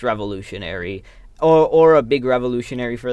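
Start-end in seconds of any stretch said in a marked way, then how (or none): none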